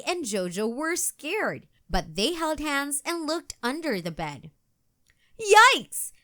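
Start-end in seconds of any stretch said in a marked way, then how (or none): 5.4-6.1 a very high, loud yelp; yelled yikes. A narrator's reading. Girl poked under the bed, loud startled yelp. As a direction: yelp as if something grabbed you. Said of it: "yelled Maddie".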